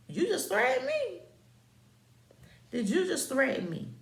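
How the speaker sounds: loud, very high indignant challenge; outraged disbelief. Retold or imitated. Acted outrage at perceived threat.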